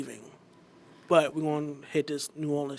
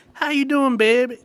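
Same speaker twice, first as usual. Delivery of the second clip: higher, louder mock pet-name greeting; imitating sweet-talk; 9 semitones higher, 6 dB louder than usual. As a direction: mimic her annoying "baby" habit, pitched up. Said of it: "when you do the".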